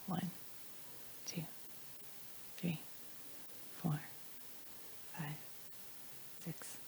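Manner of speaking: very quiet count with long pauses. Shown as a demonstration. Speaker counting out a seven-second delay for the audience, hushed. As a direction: count softly, leaving a second between numbers.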